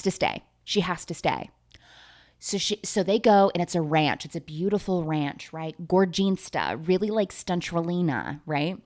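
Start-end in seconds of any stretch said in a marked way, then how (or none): none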